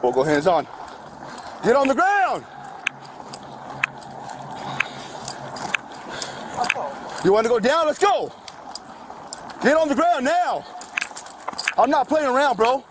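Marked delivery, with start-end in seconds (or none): 1.6-12.8 very high, urgent yelled orders; shouted surrender demands. Real, not acted. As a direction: yell at him to comply. Officer orders suspect to ground.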